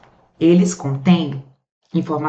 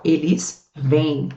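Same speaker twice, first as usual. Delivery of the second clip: slow, deliberate example; 2 semitones lower, much slower than usual. Shown as a demonstration. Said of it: "e o plural".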